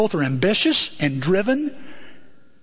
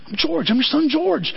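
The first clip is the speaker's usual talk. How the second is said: higher, anxious son begging to be known; pleading George; 7 semitones higher than usual. Retold or imitated. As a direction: George begs his mother to recognize him, higher. George pleading with his mother.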